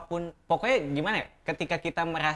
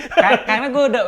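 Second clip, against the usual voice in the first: laughing; 12 semitones higher, 15 dB louder than usual. Real, not acted. Answers through laughter.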